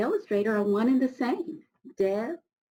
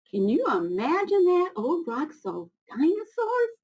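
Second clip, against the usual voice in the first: high, louder amazement; amazed wonder; 8 semitones higher, 3 dB louder than usual. Real, not acted. Reader amazed for children that Old Rock saw dinosaurs.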